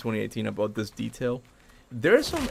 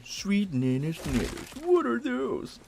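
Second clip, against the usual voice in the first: slow put-on voice climbing much higher; drawn-out character catchphrase, pitched up high at the end; Brok; 9 semitones higher, 4 dB quieter, much slower than usual. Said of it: "He was Brock impression at some point".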